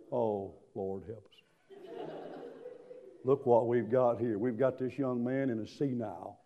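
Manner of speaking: low, hushed aside about a crazy customer; imitating worried staff under their breath; dismayed onlooker. Imagined onlookers muttering about the shouter.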